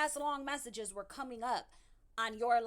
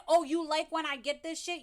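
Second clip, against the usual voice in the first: mocking imitation; 5 semitones higher, 7 dB louder than usual. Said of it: "trying to say".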